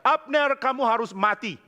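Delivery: high-pitched mock shout of accusation; retold shout. David to Abner from the hill, high-pitched retold shout. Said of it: "Lalu yang teriak mengatakan".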